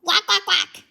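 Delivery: playful quacking in a comic; duck quacks. Acted. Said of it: "and Dark said".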